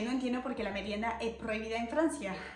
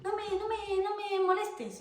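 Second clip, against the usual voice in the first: very high put-on don't-bother-me; mock irritated; 10 semitones higher than usual. Vlogger defending her mate time, very high mock brush-off. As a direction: brush off a nag.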